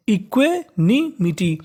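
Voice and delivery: Equanimity slowly, part by part; teacher slowly splitting equanimity into pieces; broken into parts. Shown as a demonstration. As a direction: sound out equanimity with gaps between the parts. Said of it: "अब इसको एक साथ पढ़ते हैं".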